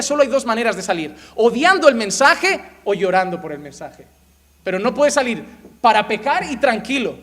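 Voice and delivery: loud, high preaching to the church; fiery preaching. Acted. Pastor preaching to the congregation, loud and fervent. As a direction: preach it hard from the pulpit.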